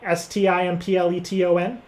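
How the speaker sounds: usual speaking voice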